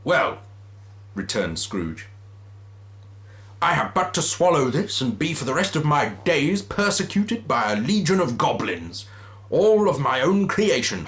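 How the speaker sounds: lightly voiced grumpy Scrooge. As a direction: scoff at the vision. Scrooge scoffs at the ghost.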